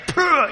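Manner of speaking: sharp, very high blown-out pugh; exasperated puff. Real, not acted. Fed up with prison, sharp exasperated puff. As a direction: blow out the exasperation.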